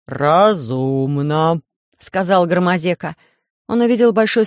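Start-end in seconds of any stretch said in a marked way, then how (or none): 0.1-1.6 low, heavy, dismayed giant; low alien voice. A narrator's reading. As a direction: rumble it like a big clumsy alien.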